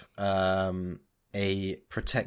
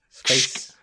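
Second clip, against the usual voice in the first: segment announcer; 3 semitones higher, 5 dB louder than usual. Acted.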